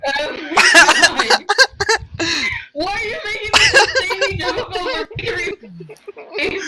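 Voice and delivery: loud exasperated laughing; cracking up mid-sentence; laughing hard. Real, not acted. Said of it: "trying not to laugh".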